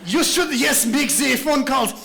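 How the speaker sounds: divert them busily in mock-French accent; distractor's French accent. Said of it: "I don't know why they have accents".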